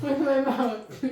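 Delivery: laughing through the words. Real, not acted. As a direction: giggle it out. Laughing repeat of her answer.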